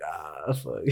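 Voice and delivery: half-asleep parent groaning at the wake-up; groggy groan. Retold or imitated. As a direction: moan like you were just woken up. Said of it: "it'd be like".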